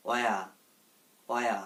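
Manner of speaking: "অয়া" as a short "wea", no h; silent h. Shown as a demonstration. Teacher giving learners the correct form of where, "অয়া" with silent h. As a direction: open "অয়া" on a w glide, ending without an r. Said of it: "এটার উচ্চারণ হবে".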